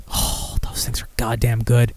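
whispered aside; whispering. Real, not acted. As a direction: whisper it to yourself, savoring. Aside about cookie cake.

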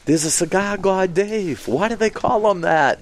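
lightly voiced thrilled fan; excited question. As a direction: ask it bursting with excitement. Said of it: "he was all excited".

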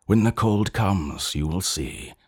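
ordinary delivery

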